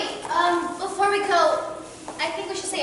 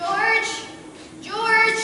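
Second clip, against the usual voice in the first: calling out; 7 semitones higher than usual. Acted. Calling out for George.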